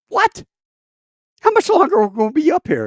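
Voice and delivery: very high, loud and fast frightened voice; imitating his own scared self in the back seat; panicked squeak. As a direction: squeal it like you're terrified. Retold panic high in the sky.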